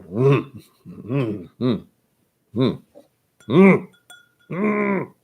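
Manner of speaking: wordless pointing grunts, rising in pitch; mimed grunts. Shown as a demonstration. Ordering abroad without the language, imitated grunts. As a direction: grunt as if asking for a drink by pointing. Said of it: "just grunts and groans".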